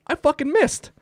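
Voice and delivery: squeaky, incredulous outburst; high-pitched disbelief. Retold or imitated. Hunter after a shot from four feet, high-pitched disbelief. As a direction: wail it in comic dismay, way up high. Acts out dismay at missing.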